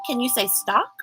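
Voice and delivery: usual speaking voice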